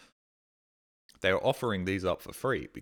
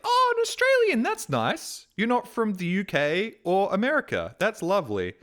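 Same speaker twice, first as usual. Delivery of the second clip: much higher, louder, sing-song and pleased; sweet delighted local; 11 semitones higher, 4 dB louder than usual. Retold or imitated. The rest of the world greeting an Australian, sweetly delighted. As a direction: coo like a charmed stranger.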